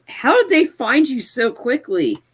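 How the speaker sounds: high, drawn-out incredulous question; baffled, stretching the question out. Retold or imitated. Baffled reaction to the film's plot.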